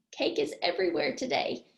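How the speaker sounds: ordinary talk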